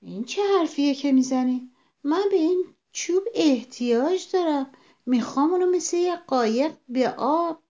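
high-pitched, mildly indignant protest; astonished. A narrator's reading. Frog protesting that he needs the stick, astonished. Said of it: "با تعجب پرسید".

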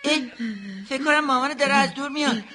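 excited, high, fast news that mum is coming. Acted. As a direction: blurt it out, thrilled. Spotting mother from afar.

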